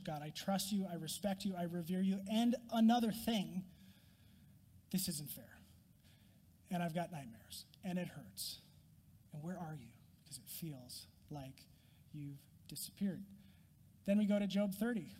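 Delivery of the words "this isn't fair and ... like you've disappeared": soft, lower, unvoiced confession to God; whispered lament after worship; whispered prayer. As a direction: breathe the complaint like a secret.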